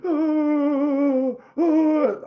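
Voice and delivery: high falsetto wailing oh; mock sob. The sobbing neighbor during the hug, in a high wail. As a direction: wail the oh through fake tears. Crying neighbor hugging him.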